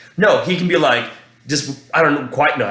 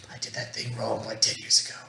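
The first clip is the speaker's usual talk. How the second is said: breathy whisper, barely voiced; whispered, lying awake replaying an old mistake; whispered inner worry; 4 semitones lower, 14 dB quieter than usual. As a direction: mutter a regret to yourself in the dark. Inner thought lying in bed.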